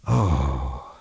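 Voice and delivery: low, deflated sigh of an oh; tired, disappointed oh. A narrator's reading. Steve finds only a bear, tired and disappointed. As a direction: let the air out, let down. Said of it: "he let out a tired".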